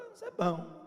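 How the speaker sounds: high, light mock approval; feigned approval. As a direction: sound pleased but noncommittal. Son feigns mild approval. (aprovação fingida em tom agudo)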